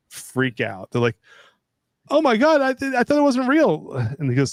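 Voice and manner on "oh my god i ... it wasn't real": put-on high, loud panicked kid voice; scared kids. Kids crying out after the scare, high panicked voice. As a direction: squeal it like a terrified child.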